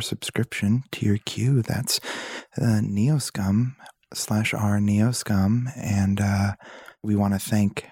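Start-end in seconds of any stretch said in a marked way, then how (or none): none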